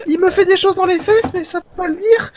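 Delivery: put-on very high squeaky kid voice; high child voice.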